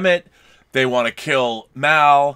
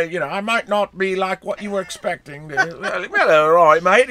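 higher-pitched British sheriff slipping into Australian; botched British accent; 5 semitones higher, 4 dB louder than usual. Acted. Podcaster imitates the British sheriff in the movie, botched accent. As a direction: attempt British, land in broad Australian. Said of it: "that's Australian. I don't know what accent".

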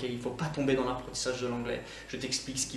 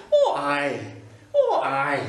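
broad Scottish "Oh aye", drawn out; Scottish accent; 11 semitones higher, 5 dB louder, much slower than usual. Shown as a demonstration. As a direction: say it like a true Scot. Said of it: "typiquement écossaises". (accent écossais bien marqué)

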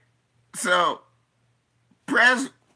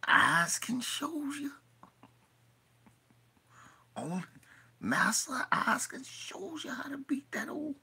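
breathy unvoiced whisper, higher; whispered old servant voice; 8 semitones higher, 10 dB quieter than usual. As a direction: hiss it like a scheming old servant. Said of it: "He said".

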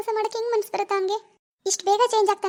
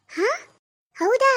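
a sharp, loud, high rising ಹೌದಾ; shocked; 3 semitones higher, 3 dB louder, much slower than usual. Acted. Mother-in-law hears of her son, shocked disbelief. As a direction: gasp it out in disbelief.

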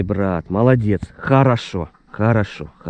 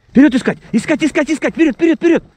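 high urging shout; 3 dB louder, faster than usual. Real, not acted. Urging the dog to search.

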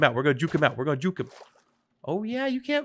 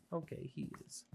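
whisper; 2 semitones lower, 15 dB quieter than usual. Real, not acted. Sneaking back to her stuff.

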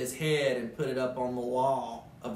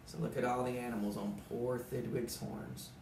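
plain reading voice

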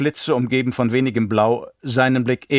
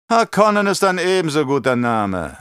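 grumbling; 6 semitones higher, 4 dB louder than usual. Acted. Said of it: "brummte der Baron".

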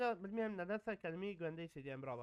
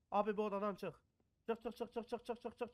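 ordinary talk

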